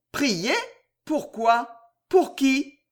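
short questions lifting in surprise, separated by pauses; astonished questioning. A narrator's reading. Jeanne questioning her mother's call to pray, mildly astonished.

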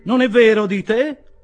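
newcomer's forceful question; loud brisk query. Acted. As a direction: check it with him over the noise. Young man queries Pickwick's denial.

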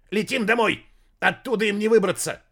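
high, loud frantic yelling; panicked shout. Acted. Panicking master yells.

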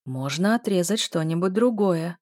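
plain reading voice

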